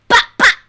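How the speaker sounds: loud, high pop pop gunshot noises; mock gunshots. Imitating shooting back at a threatening partner, loud pop pop. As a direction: shout out pretend gunshots.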